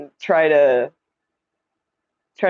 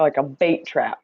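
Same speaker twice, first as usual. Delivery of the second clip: usual speaking voice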